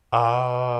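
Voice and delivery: long open ah on the out-breath; open ah exhale. Shown as a demonstration. Breathing teacher leads the class in the ah exhale, long open sound. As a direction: let the breath go on a relaxed ah. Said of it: "changing the sound here".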